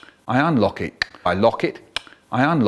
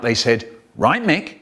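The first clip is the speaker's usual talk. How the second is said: plain reading voice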